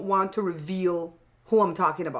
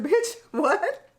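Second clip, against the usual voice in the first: much higher, laughing what; laughing disbelief; 9 semitones higher than usual. Retold or imitated. To her sister, much higher laughing disbelief. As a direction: shriek it through a laugh. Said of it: "I said".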